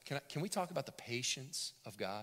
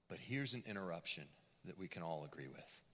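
usual speaking voice

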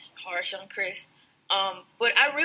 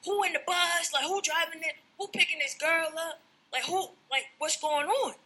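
higher, frantic imitation of Chris spazzing; mock panic; 6 semitones higher than usual. Chris panicking over the girl on the bus, higher and frantic. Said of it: "So he spazzes".

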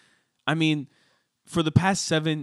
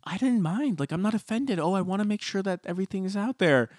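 mock Leah; 5 semitones higher, 4 dB quieter than usual. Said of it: "where Leah's like".

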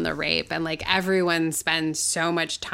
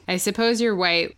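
ordinary delivery

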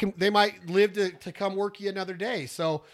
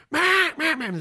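loud high quacks, falling; standing on the first note; 10 semitones higher, 7 dB louder than usual. Shown as a demonstration. Caller hailing two hundred ducks overhead, loud quacks. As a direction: hit the first note hard. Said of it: "I'm gonna stand on that first note".

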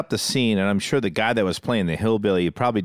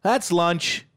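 director calling cut; 10 semitones higher, slower than usual. Retold or imitated. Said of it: "then the director goes".

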